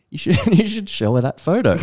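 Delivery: laughing through mum's line. Retold or imitated. Retelling mum's advice, laughing through it. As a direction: giggle out the suggestion.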